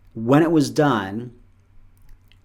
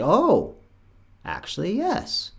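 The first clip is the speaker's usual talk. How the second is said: mock realization; 7 semitones higher, slower than usual. Person remembering more to say.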